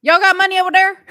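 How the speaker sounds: high, loud go at copying slang; attempted slang imitation. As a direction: belt out a put-on "Y'all got money over there?" high and loud. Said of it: "I can't talk like that".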